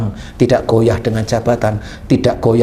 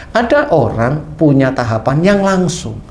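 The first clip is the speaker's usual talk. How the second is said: usual speaking voice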